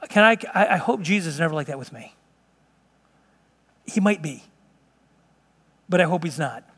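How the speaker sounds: usual speaking voice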